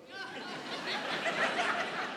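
high breathless silent laugh; high breathy laugh. Real, not acted. Preacher laughing at his own anger, high breathless wheeze. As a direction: let a wheezy laugh escape. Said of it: "man, I, I was mad".

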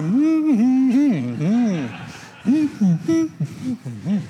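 high, loud closed-mouth mm-hmms; mute mm-hmm humming. Retold or imitated. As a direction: answer only by humming, unable to speak. Mute husband answering by humming.

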